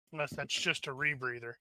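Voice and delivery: normal talking voice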